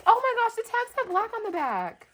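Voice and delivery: high-pitched, gasping excitement; gasp, then gush up high; overwhelmed delight. Real, not acted. Thrilled at the gift.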